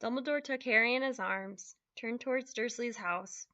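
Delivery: plain reading voice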